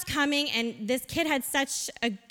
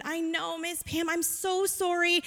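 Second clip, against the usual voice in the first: put-on soft, higher voice of a sorry child; retold tearful apology; 5 semitones higher, 4 dB quieter than usual. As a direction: imitate a weeping boy mildly, without sobs. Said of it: "weeping and sobbing uncontrollably".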